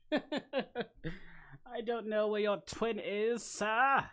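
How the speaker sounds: high, loud, very slow teasing repeat; mock drawn-out high voice. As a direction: stretch every word like a mocking cartoon. Mocking repeat of own answer.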